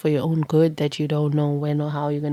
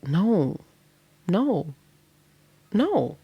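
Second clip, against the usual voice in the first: mock refusal; 3 semitones higher, much slower than usual. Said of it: "And you like".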